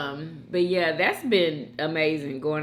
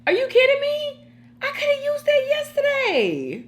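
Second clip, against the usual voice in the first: high-pitched, louder disbelieving cry; surprised; 4 dB louder than usual. Real, not acted. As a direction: shriek it in happy disbelief. Learns partner already has sweatbands.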